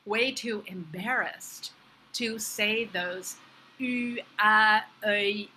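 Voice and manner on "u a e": "U, A, E" as isolated French vowels; French vowel sounds. Shown as a demonstration. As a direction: draw out French vowels "U, A, E", rounded lips on U. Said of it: "and other French sounds".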